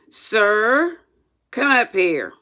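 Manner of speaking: loud schoolmaster order; strict teacher calling a pupil forward; stern command. Acted. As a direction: summon him with authority. Schoolmaster summons Tom.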